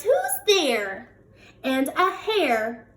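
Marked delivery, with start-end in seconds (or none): 0.0-1.1 mouse calling out. Acted. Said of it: "Mouse cries".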